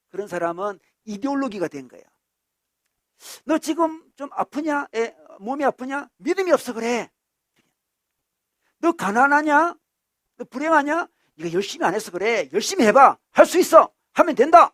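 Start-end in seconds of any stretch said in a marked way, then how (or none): 3.4-14.7 high, loud challenge and exhortation; hectoring insistence. Acted. Zealot blaming the poor and sick, hectoring insistence. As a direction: berate them, then urge them on loudly. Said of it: "이렇게 주장합니다".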